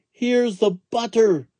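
put-on high child's shout; excited shout. A narrator's reading. Jack shouts to his mother, high. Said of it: "shouted Jack".

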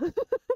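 a burst of high giggling; high laugh. Real, not acted. Laughing at the joke. (risa aguda)

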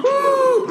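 high-pitched sudden gasp; excited gasp. Real, not acted. Reactor sees the backpack revealed, excited gasp. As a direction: suck in a high gasp of surprise.

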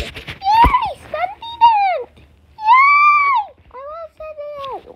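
high squealing cheer for an idol; excited cheering. Real, not acted. Gamer cheering on his idol Sentinet, squealing high with excitement. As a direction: cheer like a thrilled fan.

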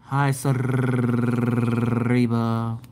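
very slow, low drone; monotone. Shown as a demonstration. Mocking a joyless greeting to a viewer, dragged monotone. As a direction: drag out the name lifelessly. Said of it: "how monotone your ass is".

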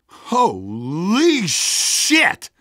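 a slow, drawn-out gasp of disbelief; stunned exclamation. Acted. Rebel sees the sawmill hit and gasps in slow disbelief. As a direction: let the words stretch in shock.

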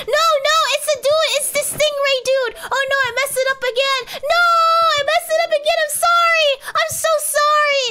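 high, loud frantic wail; distraught, pleading cry of regret; panicked apology. Real, not acted. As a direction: blurt it out in flustered panic. Distraught at repeated mistake, apologizing.

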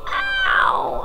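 squealed, drawn-out ow; high mock-pain yelp. Hurt in the game.

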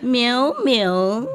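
imitated meows. Shown as a demonstration. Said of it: "This is for you".

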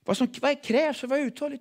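normal talking voice

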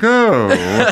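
high, haughty upper-crust drawl; rich guy voice. Retold or imitated. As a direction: play the snooty rich man. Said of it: "that guy's like".

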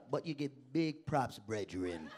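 low, softened Jamaican patois; nice Jamaican. Retold or imitated. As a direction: imitate a friendly Jamaican giving praise. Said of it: "But Jamaicans are so nice".

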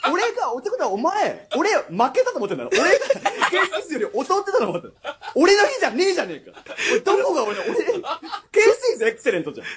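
high, laughing mock indignation; laughing outrage. Real, not acted. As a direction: rant through laughter at the judge.

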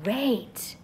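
usual speaking voice

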